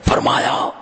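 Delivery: high, slow mock proclamation; strained mock bellow, drawn out word by word; imitating a yelling preacher. Showing how a cleric yelled his quote, slow mock bellow. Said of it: "बहुत जोर से वो हजरत अली का इरशाद सुना रहे थे".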